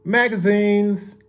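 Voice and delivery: drawn-out, high newsboy call; hawker's shout. A narrator's reading. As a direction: call your wares out to passers-by, high and slow. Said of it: "shouted Mario as they hurried by".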